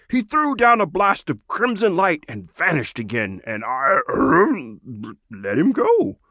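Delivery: a friendly frog returning a treasure; Krogrog the frog. Acted. Frog returns the sword to the lizard, lightly voiced. Krogrog admits he let him go.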